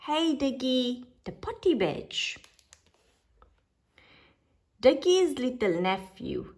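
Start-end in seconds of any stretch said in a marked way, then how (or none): none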